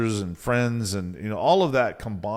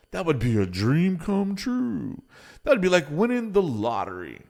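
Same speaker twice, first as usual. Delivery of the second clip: higher, dreamy put-on client voice; naysayer; 6 semitones higher than usual. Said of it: "they'll say".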